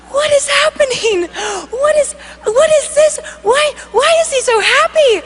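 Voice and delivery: high-pitched, incredulous questions; acted wide-eyed disbelief; amazed bewilderment. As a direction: ask in astonishment, stumbling over the words.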